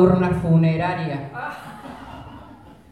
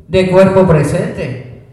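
loud proclamation; 7 dB louder, slower than usual. Acted. Repeats it, proclaiming himself.

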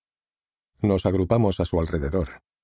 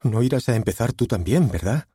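raised, upset pleading; distraught; 6 semitones higher than usual. A narrator's reading. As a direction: shaken disbelief, voice lifted. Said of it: "dijo trastornada".

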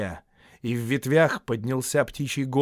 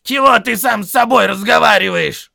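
loud, high quarrelsome troll; Том; 11 semitones higher, 14 dB louder than usual. A narrator's reading. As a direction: challenge him in a high yell. Troll Tom challenges Bert.

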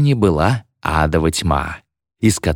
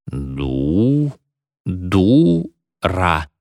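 deliberate, hollow table-rapping answer; distinct spirit reply; much slower than usual. A narrator's reading. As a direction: spell it out like a spirit at a seance. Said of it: "отчётливо ответил император Наполеон".

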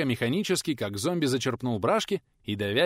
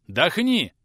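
stern command; 6 semitones higher, 7 dB louder than usual. A narrator's reading. Old man orders the boy.

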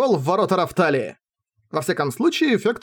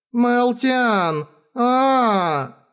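high, drawn-out wail of a name; plaintive, almost-crying call; near tears; 8 semitones higher, much slower than usual. Acted. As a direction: cry out as if about to burst into tears. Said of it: "Фира чуть не расплакалась".

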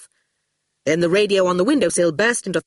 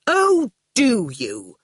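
slow, higher sneering comeback; nasty retort; 4 semitones higher, much slower than usual. A narrator's reading. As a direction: draw it out nastily. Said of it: "Quentin said nastily".

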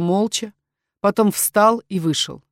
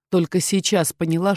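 plain reading voice

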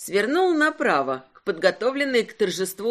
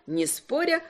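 ordinary delivery

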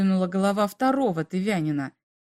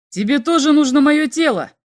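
loud, higher furious roar; 5 semitones higher, 8 dB louder than usual. A narrator's reading. Captain Igor roars at the third alien, furious. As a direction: roar it at him before shoving. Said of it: "взревел капитан".

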